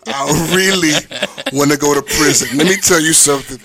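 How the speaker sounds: ordinary talk, nothing marked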